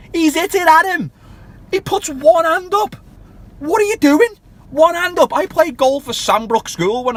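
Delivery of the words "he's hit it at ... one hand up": higher and louder, incredulous; can't believe what he's seeing; incredulous fan ranting at the goalkeeper; exasperated outburst. Real, not acted. Exasperated at keeper's blunder.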